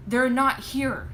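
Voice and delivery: pleading it out, breaking; anguished protest, drawn out; distraught cry. A narrator's reading. Panicked daughter.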